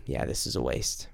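whispered, giving up, barely voiced; defeated whisper. Whispering to God that praying is a waste, defeated. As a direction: let it slip out as a hopeless whisper.

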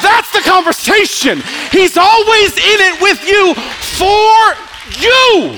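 very high, loud, held-out words; real full-force pulpit shout; climactic preaching shout. Real, not acted. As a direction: shout it to the rafters, stretching "for" and "you". Climax of the sermon.

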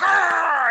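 sudden high, loud imitated dog bark. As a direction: bark it out like an angry little dog. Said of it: "the dog's like".

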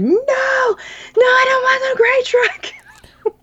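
put-on high, slow crying wail of a little boy; imitating her toddler sobbing no to the truck; mock toddler wail. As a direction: wail it like a three-year-old in tears. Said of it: "son refusing the horn offer".

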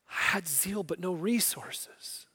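slow, whispered lament; regretful whisper. Retold or imitated. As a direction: breathe it out in regret. Peter weeping after denial.

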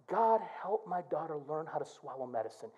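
low and soft, whispered plea; soft earnest prayer. Acted. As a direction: pray it quietly for your little girl. Repeats his quiet prayer.

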